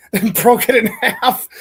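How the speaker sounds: cracking up mid-sentence, slow; reading through real laughter; laughing. Real, not acted. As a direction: laugh out the words while reading. Laughs while reading a line.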